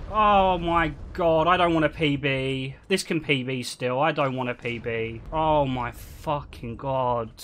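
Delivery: low, subdued and defeated; dejected disbelief. Real, not acted. Speedrunner dreading a PB after disaster, dejected. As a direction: groan it out low, drained of hope.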